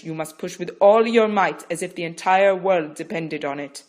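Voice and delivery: loud, insistent coaching; firm instruction. Acted. Midwife instructing Rachel, firm and loud. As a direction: steel her for the final push.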